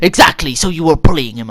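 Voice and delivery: loud, triumphant teacher impression; mock accusing outburst. Staff member catches student admitting bullying, loudly accusing. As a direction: pounce on the admission, loudly.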